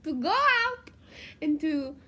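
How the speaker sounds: put-on high, loud French accent through giggles. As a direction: put on a French accent while trying not to laugh. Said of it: "answer a question in a French accent".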